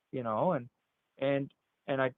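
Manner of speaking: ordinary talk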